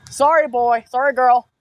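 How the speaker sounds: usual speaking voice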